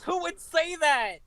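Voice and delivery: high, shocked disbelief; incredulous. Real, not acted. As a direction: squeal it in disbelief. Incredulous reaction.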